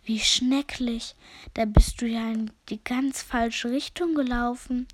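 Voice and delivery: laborious hesitant snail. Acted. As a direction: drag the words out with effort. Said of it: "mühsam hervordrucksen".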